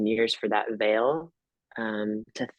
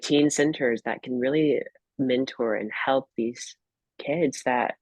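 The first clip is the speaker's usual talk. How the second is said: normal talking voice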